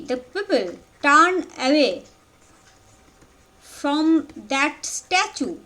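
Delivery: measured dictation with long pauses between phrases; dictating. Real, not acted. Teacher dictating notes for students to write down, halting, spaced-out delivery. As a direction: dictate word by word, leaving time to write.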